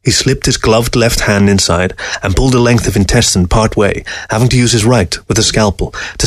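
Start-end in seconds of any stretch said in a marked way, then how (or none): none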